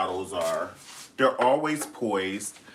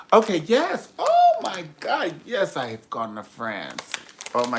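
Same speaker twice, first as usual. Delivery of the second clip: mock gushing diva; 5 semitones higher than usual. Hammed-up diva gushing to herself. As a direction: gush like a pampered diva.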